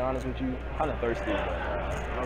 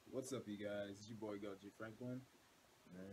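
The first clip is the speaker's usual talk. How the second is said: hushed early morning; 17 dB quieter than usual. Real, not acted. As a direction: keep it hushed, folks asleep. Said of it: "about three o'clock in the morning".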